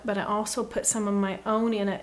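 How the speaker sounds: ordinary talk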